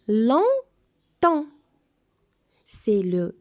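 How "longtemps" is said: "Longtemps" read out clearly, louder and higher; model word reading. Shown as a demonstration. Teacher reads "Longtemps" aloud to the class, clear and loud. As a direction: say "Longtemps" slowly and distinctly for the pupils. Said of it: "Je vais le lire".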